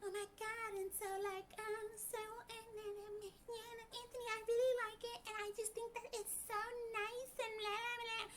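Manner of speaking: mocking a contestant's put-on sweet high voice; mock girly voice. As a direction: parody a sugary femininity-video voice, way up high and soft. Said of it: "she talks like this".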